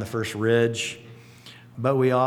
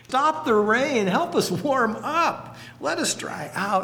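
high, slow pleading to God; retold desperate prayer, lightly acted; 10 semitones higher, slower than usual. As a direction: beg the sky to stop.

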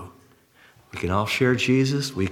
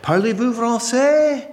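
high, drawn-out eager questioning; old lady's question; 10 semitones higher than usual. Retold or imitated. Blind old lady asks passing schoolboys about French, high and eager. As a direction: imitate an old lady calling out to passers-by. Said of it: "she'd say".

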